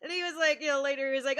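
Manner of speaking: ordinary talk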